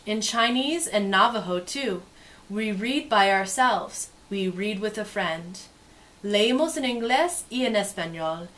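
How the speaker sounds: lively, sing-song storybook read; reading to children. Acted. Teacher reading a picture book to kids, lively and sing-song. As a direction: make the page sparkle for little listeners.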